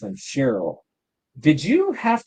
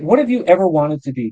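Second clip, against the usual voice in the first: ordinary talk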